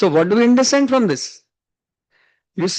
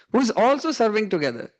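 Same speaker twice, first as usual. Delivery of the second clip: ordinary talk, nothing marked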